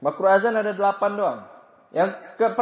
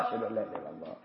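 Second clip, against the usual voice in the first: much softer, lower murmured reply; quiet reply; 13 semitones lower, 15 dB quieter, much faster than usual. Shown as a demonstration. Preacher voices the listener quietly answering the muezzin. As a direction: answer under your breath. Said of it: "Itu metode pertama".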